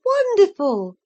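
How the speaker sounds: high, loud delighted cry; exclaimed. Acted. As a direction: burst out in bright mock delight. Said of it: "I exclaimed".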